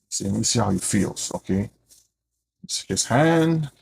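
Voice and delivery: put-on low, soft voice of an old father feeling his son; blind old Isaac. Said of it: "Isaac is doing the physical touch".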